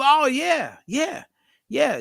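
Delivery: high eager yeahs; eager yes. Retold or imitated. Smiley telling Ferg about his diet, eager and high. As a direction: gush a quick string of yeahs. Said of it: "He goes".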